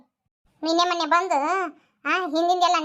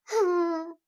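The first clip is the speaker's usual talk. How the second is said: choked sob; much slower than usual. Acted. Worried grandmother sobs.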